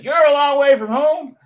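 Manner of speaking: high, loud American caricature; terrible American accent. Retold or imitated. Local man marvelling at a visitor from Australia, hammed-up American accent. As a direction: exclaim it in a put-on American accent. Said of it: "that terrible American accent".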